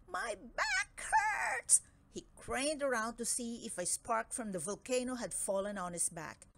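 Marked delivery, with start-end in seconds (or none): 0.1-1.8 very high, slow crying dragon voice; whining dragon. Acted. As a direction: wail it like a hurt dragon. Dragon in pain.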